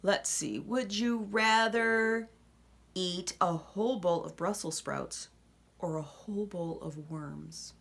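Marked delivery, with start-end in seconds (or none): none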